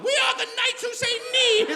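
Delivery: very high, loud Monty Python knight; Knights Who Say Ni. Acted. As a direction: squawk it like a silly comedy knight. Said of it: "is this Monty Python?".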